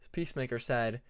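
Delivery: usual speaking voice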